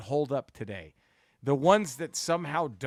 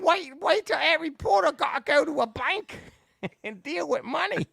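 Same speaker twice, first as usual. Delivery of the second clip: shrill falsetto Monty Python housewife, much higher and louder; Monty Python lady; 6 dB louder than usual. Retold or imitated. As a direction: squawk it like a Python pepperpot. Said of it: "made her like every female Monty Python character".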